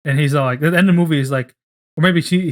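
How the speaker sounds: normal talking voice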